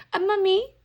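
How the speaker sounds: a high, bad English-accent mummy. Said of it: "I'm not good at my English accent".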